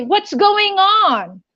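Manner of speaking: high, drawn-out exclamation; exclaimed question. Shown as a demonstration. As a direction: demonstrate a big, slow, incredulous-style question. Acting out the question to translate.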